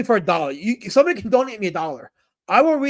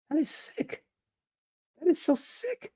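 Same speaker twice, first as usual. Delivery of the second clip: high, softer voice of disgust; high-pitched disgust; 9 semitones higher, 7 dB quieter than usual. Real, not acted. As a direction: recoil in a high, small voice. Mock disgust at chat's accusation.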